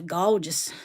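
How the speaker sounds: gorgeous as "galjus", Georgia drawl; Georgia accent. Retold or imitated. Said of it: "as they say in Georgia".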